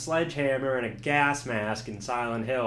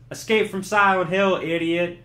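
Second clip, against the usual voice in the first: higher, louder taunting announcement; mock game announcer; 6 semitones higher, 7 dB louder than usual. The game taunting the player, loud. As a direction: boom it like a gloating game. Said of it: "the game immediately says".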